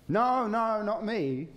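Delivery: high, loud panicked denial; frightened Peter brushing off the servant girl, high and loud; Peter's denial. Retold or imitated. Peter denies knowing Jesus.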